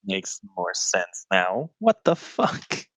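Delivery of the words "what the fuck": high, slow, disbelieving; incredulous outburst. Real, not acted. Player protesting the bugbear odds to game master, incredulous. Player dismayed at the enemies' strength.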